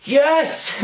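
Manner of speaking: high delighted cry; a high, joyful yelp; kid's triumph. Retold or imitated. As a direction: celebrate finding the treasure. Kid sees drinks machine.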